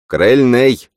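loud, high call; shouted repeat. A narrator's reading. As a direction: holler it out as a command. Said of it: "повторил лучник".

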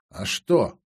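low, husky question; hoarse. A narrator's reading. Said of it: "хрипло проговорила".

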